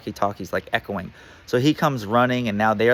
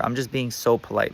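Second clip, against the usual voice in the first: usual speaking voice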